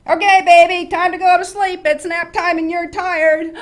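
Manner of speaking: high, blaring bedtime announcement; very loud, jarring shout; yelling at baby. Shown as a demonstration. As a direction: bellow it as if the baby were deaf. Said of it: "she would think you were yelling at her".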